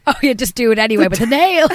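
giggling at the toe-sucking scene while talking; laughing. Real, not acted. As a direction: laugh the words out.

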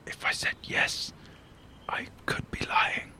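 slow hushed whisper; conspiratorial whisper. Acted. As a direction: whisper back like a spy. Secret spy mission reply.

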